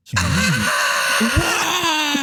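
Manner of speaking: high cackling laugh; shrieking laugh. Real, not acted. Laughing at mock disaster.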